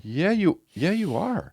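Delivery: high-pitched, mildly insistent retort; retold disbelieving correction; retold insistence. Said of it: "And I was like".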